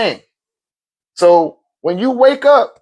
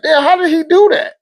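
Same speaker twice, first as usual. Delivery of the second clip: high, amazed exclaim; 10 semitones higher than usual. Retold or imitated. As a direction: marvel at what the kid did. Father wonders at son.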